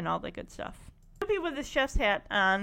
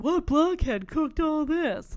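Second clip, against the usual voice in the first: lightly voiced boy character, slow; a hint of an indignant kid voiced by a man, drawn out; mock guy's voice; 4 semitones higher, 4 dB louder, slower than usual. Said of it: "that's a guy doing the voice".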